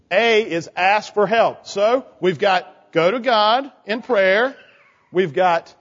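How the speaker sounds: ordinary delivery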